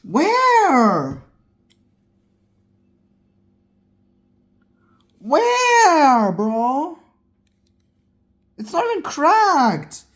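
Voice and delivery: high, loud stunned shriek; can't believe the luck; shrieking disbelief. Real, not acted. Screen not cracked, disbelief.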